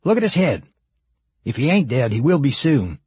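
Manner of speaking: high-pitched, callous cop; cop's insistent, unsympathetic voice; second cop character. A narrator's reading.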